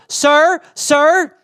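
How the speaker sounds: high, insistent mock call; high-pitched mimicked hail; crazy stranger. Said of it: "a crazy person in his neighborhood".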